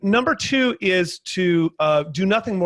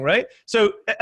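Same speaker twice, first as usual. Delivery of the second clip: normal talking voice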